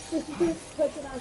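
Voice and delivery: usual speaking voice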